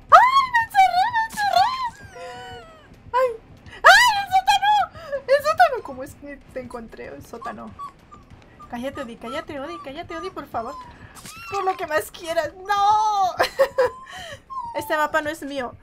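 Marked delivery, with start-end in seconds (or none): 0.1-4.0 high, loud, panicked cry; panicked shout. Real, not acted. Player trapped in the basement, real panicked cry. 4.2-5.8 high, loud cry of dismay; alarmed shout. Real, not acted. Player dragged to the basement, loud dismayed cry. 12.5-14.7 laughing despair. Real, not acted. Caught again, despair.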